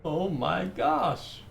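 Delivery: amazed. Real, not acted. Awed by game scene.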